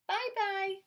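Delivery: put-on high, loud little-boy bye-bye; toddler voice. A narrator's reading. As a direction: chirp it like a tiny child. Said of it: "Marcos repeated".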